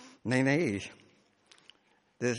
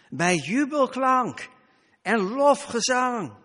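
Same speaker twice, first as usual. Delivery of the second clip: 1 jubilant proclaiming; 5 semitones higher, 6 dB louder, slower than usual. Acted. Celebrating the festive procession.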